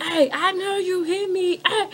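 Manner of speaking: high, stretched-out mock holler; retold yelling. Man yelling at her as she walks past, drawn-out mock yell. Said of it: "he was yelling at me".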